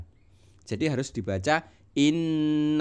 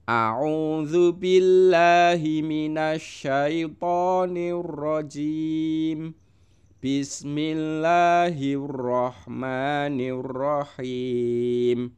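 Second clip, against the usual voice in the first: slow, chanted recitation with held vowels; chanted Quran reading; 3 semitones higher, much slower than usual. Acted. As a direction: recite the verse together with the class, slowly. Leads joint recitation.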